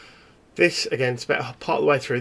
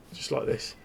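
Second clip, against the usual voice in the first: whispered; 6 dB quieter than usual. Acted. Describing dead face eerily.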